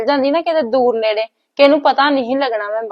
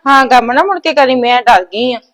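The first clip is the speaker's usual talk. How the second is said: louder, fussy warning; loud scolding; 2 semitones higher, 7 dB louder than usual. Acted. Mother loudly forbids her daughter to cut onions again. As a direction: forbid it outright, shaken.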